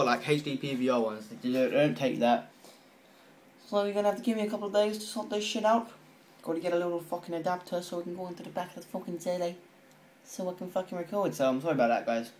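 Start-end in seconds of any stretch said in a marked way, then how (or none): 3.7-11.3 higher, fast put-on American accent. Telling viewers he needs days, in an American accent. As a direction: slip into a breezy American accent. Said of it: "talking in fucking American accent".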